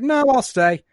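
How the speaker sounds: put-on chirpy kid deciding to stay; girl's voice. Voicing Omega staying for lunch.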